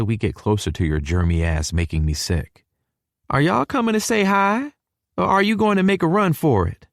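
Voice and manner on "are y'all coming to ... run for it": higher southern mom calling from another room; Mama calling out. A narrator's reading. Mama calls from the kitchen to the couple, higher and teasing. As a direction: call out teasingly, pitched up. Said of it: "Mama shouts from the kitchen".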